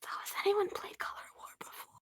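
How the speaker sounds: hushed whispered question; whispering. Acted. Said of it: "Why are we whispering?".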